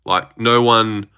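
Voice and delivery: normal talking voice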